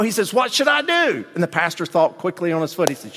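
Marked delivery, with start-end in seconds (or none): 0.3-1.2 worried man. Retold or imitated. Man asks pastor worriedly.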